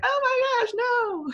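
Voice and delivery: put-on squeaky high kid protest; exasperated kid. Imitating a child correcting a silly parent, high squeal. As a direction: play the kid rolling their eyes. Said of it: "they're just like".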